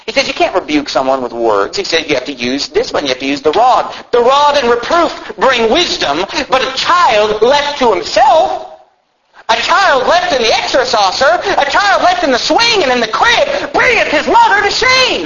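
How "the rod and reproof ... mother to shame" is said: high, drawn-out pulpit cry building to a shout; thundering preaching. Real, not acted. Preacher warning parents with a Bible verse, thundering preaching. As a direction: proclaim scripture at rising, soaring pitch.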